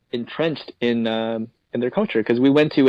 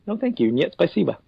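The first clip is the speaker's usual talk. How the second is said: polite refusal; 6 semitones higher than usual. Shown as a demonstration. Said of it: "politely nod and say".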